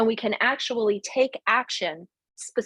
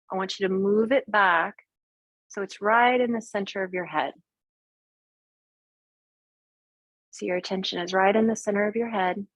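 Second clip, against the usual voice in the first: calm, unhurried meditation guide; guided meditation. Acted. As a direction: talk them gently into the visualization. Leads a guided visualization.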